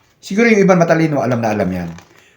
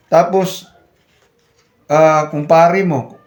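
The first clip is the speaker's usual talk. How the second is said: ordinary delivery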